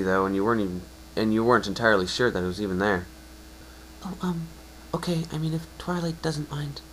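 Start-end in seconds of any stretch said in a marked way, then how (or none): 4.0-6.8 high, soft, hesitant pony voice; shy little voice, pitched up and hushed; timid mare. Acted. Shy cousin hesitantly agreeing, high and soft.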